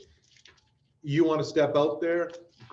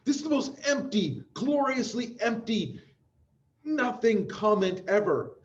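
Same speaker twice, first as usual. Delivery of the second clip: awed; 8 semitones higher than usual. Real, not acted. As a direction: marvel at it. Marveling at lyric.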